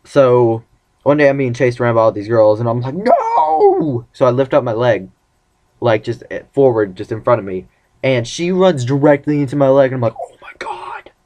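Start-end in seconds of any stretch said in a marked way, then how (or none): 3.0-4.1 high, drawn-out comic "no"; panicked mock no. Boy being chased by all these girls, panicked high wail. 10.2-11.1 high, hushed gasp of shock; horrified whisper. Retold or imitated. Kid whose leg she ran into, horrified hushed whisper. Acting out shock at her fall.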